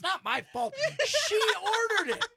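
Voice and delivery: high, loud laughing protest. Real, not acted. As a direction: laugh through the excuse. Laughing defending himself.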